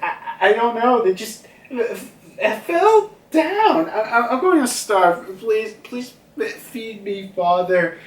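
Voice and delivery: put-on high, stammering little-kid voice; pleading child. Young Yamato begging Kaido for food, hammed-up pleading. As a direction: beg haltingly, drawing out the words.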